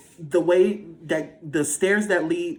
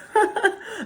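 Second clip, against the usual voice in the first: high burst of laughter. Real, not acted. Laugh alone.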